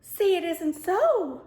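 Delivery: high, slow, delighted exclamation; delighted gasp. Retold or imitated. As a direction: squeal it out in disbelief and slow down. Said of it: "and I went".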